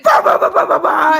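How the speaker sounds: very loud, high booming "bah"s; loud noise imitation. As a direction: blast out the sound of gunfire. Imitating deafening sound system.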